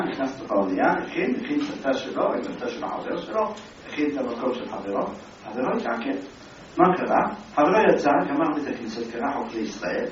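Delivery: usual speaking voice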